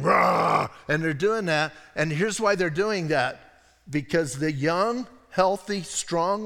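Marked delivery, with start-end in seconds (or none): 0.0-0.7 a loud, playful roar like a hungry lion; hammed-up lion roar from the pulpit; mock lion roar. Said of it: "they're hiding out and they're roaring".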